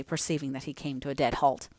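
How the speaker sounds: usual speaking voice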